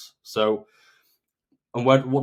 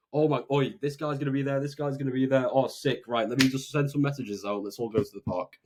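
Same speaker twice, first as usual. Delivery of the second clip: enthusiastic friend; 3 semitones higher than usual. Retold or imitated. Friend pumped about a park day, buzzing with excitement. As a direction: sell the plan like you can't wait. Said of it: "their enthusiasm and their conviction".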